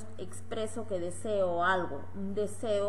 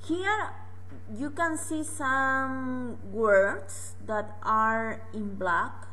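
slow teacher explanation; 4 semitones higher, 4 dB louder, much slower than usual. Shown as a demonstration. Teacher pointing out slide.

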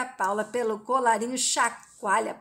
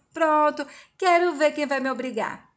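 raised, louder defiant dare; stubborn and provocative; defiant challenge; 4 semitones higher, 5 dB louder than usual. Acted. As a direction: dare them to try and force you. Paula refuses to move her car.

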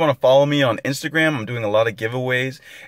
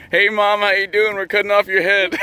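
playful teasing; 7 semitones higher than usual. Real, not acted. Teasing mom on camera.

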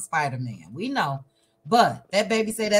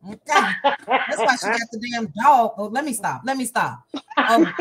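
usual speaking voice

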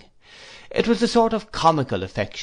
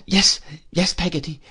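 put-on higher, sobbing child voice; sobbed; 6 semitones higher than usual. A narrator's reading. Boy answers his nurse through the keyhole, sobbing. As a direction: answer through tears, catching the breath. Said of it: "I sobbed".

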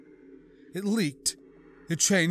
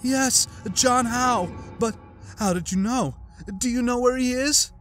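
mock eager surprise; 11 semitones higher, 3 dB louder than usual. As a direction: act startled and hopeful. Ada hears of her boyfriend.

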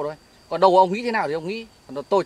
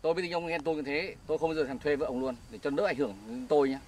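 softer, lower-pitched disavowal; low dismissive protest; 3 semitones lower, 8 dB quieter than usual. Acted. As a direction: brush off the accusation, low and quiet. Phân bua với người chồng ghen. (giọng trầm nhỏ, phân bua)

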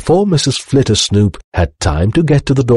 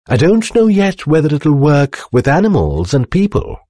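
lightly voiced inventor, higher pitch; wondering professor; 9 semitones higher than usual. A narrator's reading. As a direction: ponder aloud in a high voice. Professor ponders his next experiment.

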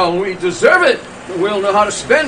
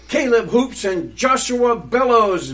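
ordinary talk, nothing marked

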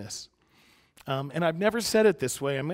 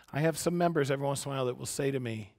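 ordinary delivery

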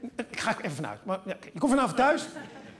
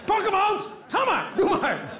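mock egging on; 11 semitones higher, slower than usual. Comedian to over-keen Amsterdam audience, mock egging on. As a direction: yell them on, drawing out the last words.

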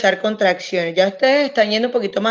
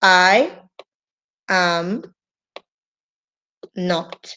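word by word; 2 semitones lower, much slower than usual. Shown as a demonstration. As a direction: dictate it one word at a time. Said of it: "tú puedes decir". (palabra por palabra, cada vez más suave)

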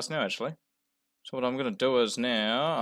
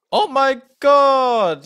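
high, loud, drawn-out exclamation; amazed yell; 12 semitones higher, 11 dB louder, much slower than usual. Real, not acted. Gamer stunned by the catch, yelling high. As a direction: cry it out slowly, way up high.

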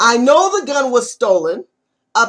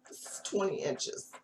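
ordinary delivery

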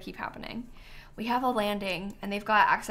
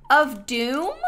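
louder, incredulous "Of Doom?"; mock dramatic; 4 semitones higher, 6 dB louder than usual. Real, not acted. Reacting to the creator's name, mock ominous. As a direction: savour the doom.

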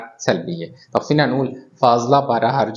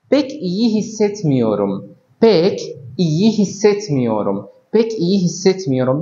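slow and higher, drilled over and over; slow repeated drill; 5 semitones higher, much slower than usual. Shown as a demonstration. Teacher drilling a Turkish phrase for students, slow and raised. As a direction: repeat the phrase slowly, pitched up, for learners. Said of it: "مثال آخر".

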